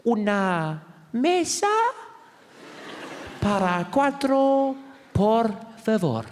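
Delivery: slow, high, over-enunciated Spanish; high slow teacher Spanish; language disc voice. Retold or imitated. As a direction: drill it slowly like a tape. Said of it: "She says".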